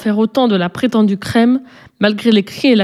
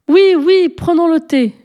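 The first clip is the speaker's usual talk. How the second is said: excited chorus; 8 semitones higher, slower than usual. A narrator's reading. Little girls answer Paul together, high and excited. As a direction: cheer like a crowd of little girls. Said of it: "répondirent toutes les petites filles ensemble".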